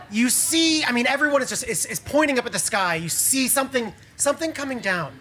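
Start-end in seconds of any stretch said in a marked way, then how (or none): 3.0-5.1 hushed scene setting. A narrator's reading. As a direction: build awe as it descends. Describes something descending from heaven.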